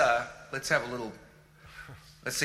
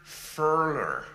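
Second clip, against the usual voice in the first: American accent; 4 semitones higher, much slower than usual. Shown as a demonstration. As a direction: labour through every American r, drawn out. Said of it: "when you do an American accent".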